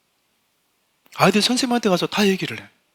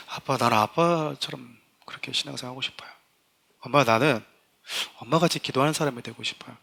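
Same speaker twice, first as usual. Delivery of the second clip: 낮고 조용하게 진심을 털어놓는 아이 흉내; 부모에게 소곤소곤 고백하듯 연기; 아이의 나직한 고백; 4 semitones lower, 7 dB quieter than usual. Acted. 아이가 부모에게 고백하는 장면. (나직하게 고백하는 아이 말투)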